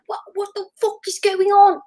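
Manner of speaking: high-pitched, exasperated actor caught off guard; Russell Crowe. Retold or imitated. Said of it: "that's my Russell Crowe impression".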